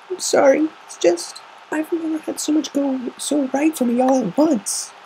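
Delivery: high filly voice, overwhelmed; apologizing through happy tears; tearful Scootaloo. Acted.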